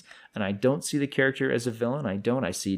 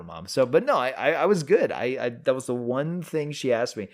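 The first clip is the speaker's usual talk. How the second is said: ordinary delivery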